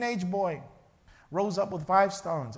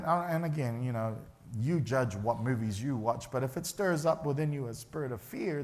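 usual speaking voice

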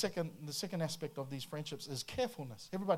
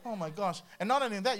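ordinary talk, nothing marked

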